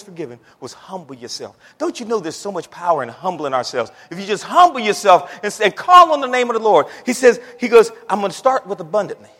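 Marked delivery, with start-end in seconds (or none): 4.1-9.3 higher, louder urging; rousing preaching. Real, not acted. Preacher urging congregation to humble themselves, higher and louder. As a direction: urge them on with rising fervour.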